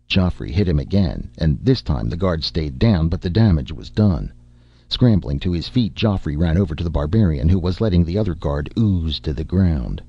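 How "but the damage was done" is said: usual speaking voice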